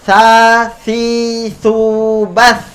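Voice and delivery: drilling each syllable for the class; recitation drill. Shown as a demonstration. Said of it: "Kita mulai dari sa". (latihan mengucapkan "sa, si, su, bas")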